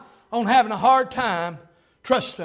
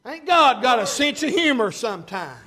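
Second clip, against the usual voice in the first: usual speaking voice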